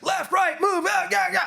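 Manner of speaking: frantic high-pitched corner yelling; mock screaming. Coach mimicking overexcited cornermen, screaming and yelling. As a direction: imitate a cornerman yelling like it's a video game. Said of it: "that person's gonna be screaming and yelling".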